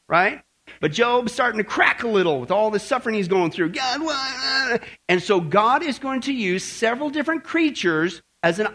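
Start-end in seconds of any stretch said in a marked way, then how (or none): 3.7-4.8 higher pleading cry to heaven; anguished cry. Acted. Job crying out to God in his suffering, a pleading cry.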